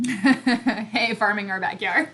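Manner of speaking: normal talking voice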